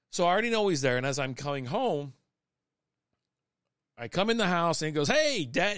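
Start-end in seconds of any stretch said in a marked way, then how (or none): 5.1-5.8 oddly over-eager hello, pitched up; weird, excited greeting. Retold or imitated. Son greets dad as he comes home, weirdly excited. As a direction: imitate an excited kid, higher-pitched. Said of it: "He says it real weird and really excited".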